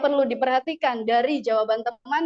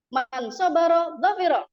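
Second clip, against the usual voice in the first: man sobaro dhofiro higher and louder, falling at the end; proper Arabic intonation; 5 semitones higher, 5 dB louder than usual. Shown as a demonstration. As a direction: recite with proud intonation. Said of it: "ada intonasinya".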